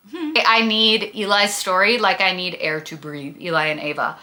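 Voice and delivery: ordinary talk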